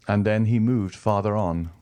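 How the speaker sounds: ordinary delivery, nothing marked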